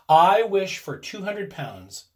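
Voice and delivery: slow, deliberate, each word clear; solemn slow wish; distinctly. A narrator's reading. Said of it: "said the old man distinctly".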